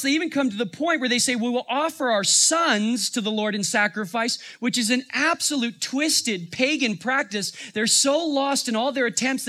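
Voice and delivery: high-pitched, urgent sermon delivery; impassioned preaching. Real, not acted. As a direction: preach it with mounting incredulity.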